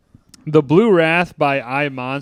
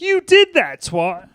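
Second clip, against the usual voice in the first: very high, loud mock accusation; quoting the reply; 10 semitones higher, 6 dB louder, slower than usual. Answering David with a very high accusation. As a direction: squeak the blame out. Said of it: "He's like".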